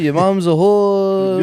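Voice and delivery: slow put-on rabbi voice. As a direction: drag it out like an old rabbi.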